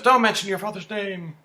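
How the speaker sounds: high, loud mock alarm; retold freak-out. Eden reacts to hearing Cece's name, mock freak-out. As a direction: imitate someone freaking out, pitched high and loud. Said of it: "Eden freaks out".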